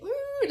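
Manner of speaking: soft, high-pitched martial-arts wail; high ninja "ooh". Retold or imitated. Patient posed ninja-like on the gurney, high mock ninja cry. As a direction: mimic a ninja warding people off.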